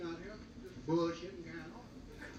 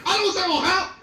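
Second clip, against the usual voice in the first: loud, higher put-on owner voice; mock restaurant owner; 8 semitones higher, 11 dB louder than usual. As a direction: protest as the owner.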